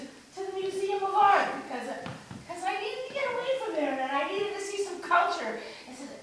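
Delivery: halting, mildly frantic confession; unsettled monologue character. Acted. Shopper confessing tears over tuna cans, lightly unhinged. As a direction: let the agitation swing in pitch.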